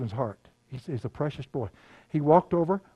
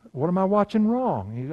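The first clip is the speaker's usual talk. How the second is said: higher, louder, slower disbelief; incredulous self-question; 7 semitones higher, 5 dB louder, slower than usual. Retold or imitated. Preacher mocking his own disbelief at God's command, incredulous. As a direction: ask it like you can't believe it.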